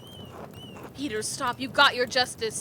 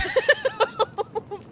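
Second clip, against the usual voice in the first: manic laugh. Acted. Man laughs as books burn.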